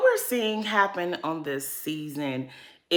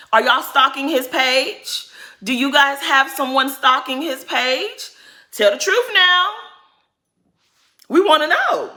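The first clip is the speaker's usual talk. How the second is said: demanding callout; 6 semitones higher, 4 dB louder than usual. Real, not acted. Calls out cast, demanding.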